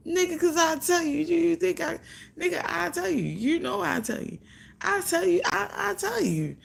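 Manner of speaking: high, excited retelling of the fight; high-pitched indignant replay; heated retelling. Retold or imitated. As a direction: replay the argument worked up. Retold heated argument with him.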